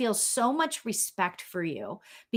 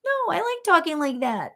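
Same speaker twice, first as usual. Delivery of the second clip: high, silly put-on voice; playfully defiant in a goofy high voice; funny voice; 7 semitones higher than usual. Mom answering daughter's complaint, in funny voice. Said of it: "I used to make these funny voices".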